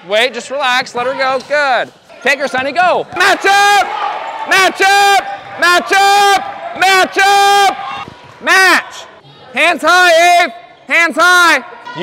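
loud, high coaching yells across the court; sideline shouting. Real, not acted. Coach yelling instructions to players on the court, loud. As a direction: call the plays out over the crowd.

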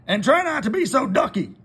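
the farmer bursting with news; excited Farmer Brown. Acted. Farmer Brown tells the cows about school, excited. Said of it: "said Farmer Brown".

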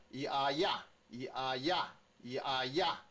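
一啊呀 as separate yi, a, then punched ya; sound blending. Shown as a demonstration. Teacher says again for the next sound, blending drill. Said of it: "再来".

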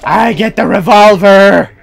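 loud, triumphant whoop; gloating shout. Real, not acted. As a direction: crow it loudly at your foes. Crowing over a weapon in game.

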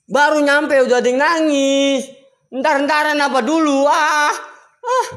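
spooked, pleading outcry; panicked protest. Real, not acted. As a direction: complain in alarm, wanting it to stop. Frightened complaint on hearing crying.

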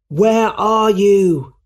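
high, loud, long-drawn call. A narrator's reading. Spider-Man searching the burning building, calling out. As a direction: call out to someone out of sight. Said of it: "he called".

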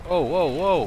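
high, loud startled whoas; alarmed surprise. Real, not acted. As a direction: react to sudden incoming fire. Sudden fire from unseen source.

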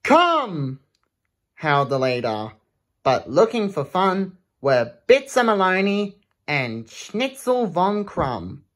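lively rhythmic read-aloud; reading a rhyming book to children; storybook reading. Acted. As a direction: bounce through the rhyme for kids. Reads picture book aloud.

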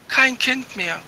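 plain reading voice

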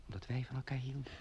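softly whispered confession; tender whisper. Acted. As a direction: breathe it to your love. Romantic dream whispered.

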